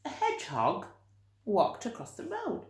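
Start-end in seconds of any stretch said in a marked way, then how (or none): none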